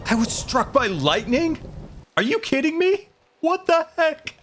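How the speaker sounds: high, shocked yelps of disbelief; startled disbelief. Real, not acted. Streamer struck by lightning, yelping in shocked disbelief. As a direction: cry out in shock at a sudden lightning strike.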